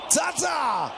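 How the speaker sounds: high shouted name; excited shout. Real, not acted. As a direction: yell the name out. Zaza chance called twice.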